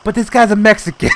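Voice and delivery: high, loud, laughing delivery; laughing punchline. Retold or imitated. Italian guy outing the worker, loud with a laugh. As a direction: blurt the punchline through laughter.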